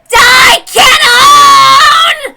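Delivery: shrieking war cry at full force; furious shout. Acted. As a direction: scream it as you fire the killing shot. Erica fires her final shot.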